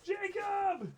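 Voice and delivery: high, excited call of his name. Real, not acted. Host calling co-host over, excited high call. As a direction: yell him over to see.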